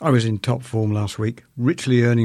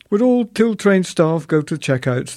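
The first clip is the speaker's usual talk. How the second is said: higher, louder announcer voice; tannoy announcement; 6 semitones higher, 4 dB louder than usual. Retold or imitated. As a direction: mimic the store tannoy, raised and louder.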